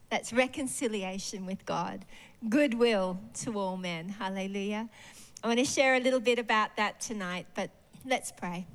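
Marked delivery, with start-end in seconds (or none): none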